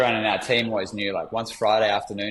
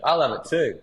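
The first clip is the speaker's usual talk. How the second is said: light hint of a small boy, higher; imitating his young son; 5 semitones higher, 4 dB louder than usual. Father quoting son agreeing, light child voice. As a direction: echo the child's sweet reply. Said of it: "he's talking like this".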